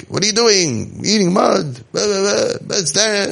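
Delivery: high, mocking nag at a kid; mock scolding.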